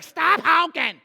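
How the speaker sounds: a touch of annoyed scolding; irritated snap. Shown as a demonstration. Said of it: "don't be like".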